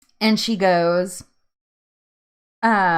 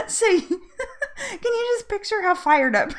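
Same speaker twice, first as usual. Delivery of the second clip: high-pitched, talking through giggles; laughing hard; 14 semitones higher than usual. Real, not acted. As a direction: barely get it out for laughing. Cracking up about fired-up kid.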